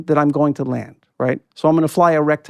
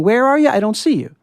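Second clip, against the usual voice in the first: worried controller; 8 semitones higher than usual. Retold or imitated. Air traffic controller losing track of a plane, anxious call. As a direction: press the pilot for his position, rising alarm. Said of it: "the controller kept saying".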